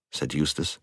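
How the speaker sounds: ordinary delivery, nothing marked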